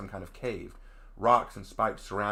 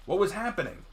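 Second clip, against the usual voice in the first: ordinary delivery, nothing marked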